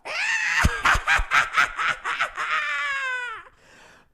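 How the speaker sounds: loud, high shrieking witch cackle; booming evil cackle; wicked witch cackle. Acted. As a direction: throw your head back and cackle like a witch. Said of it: "she bellows out this, like, wicked witch laugh".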